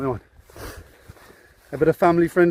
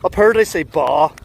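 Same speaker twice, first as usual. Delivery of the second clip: Northern Ireland accent; 2 semitones higher, 6 dB louder than usual. Shown as a demonstration. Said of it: "in a Northern Ireland accent".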